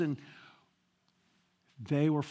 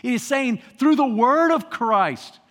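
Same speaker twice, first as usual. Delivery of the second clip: preaching at full force; 2 semitones higher, 7 dB louder than usual. Acted. Preacher urging the congregation to read the Bible, loud. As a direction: drive it home with force.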